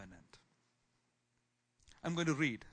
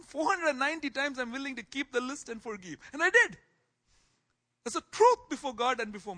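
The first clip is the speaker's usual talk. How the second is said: exasperated outburst; 9 semitones higher, 8 dB louder than usual. Acted. Exasperated account of forgiving.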